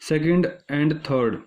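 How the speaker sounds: "second and third" spaced out word by word; slow deliberate repeat. Shown as a demonstration. Teacher weighs the answer option for students, slow and deliberate.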